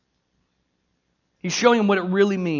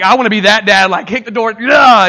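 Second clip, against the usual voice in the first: action-hero dad; 2 semitones higher, 13 dB louder than usual. Acted. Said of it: "Liam Neeson from Taken".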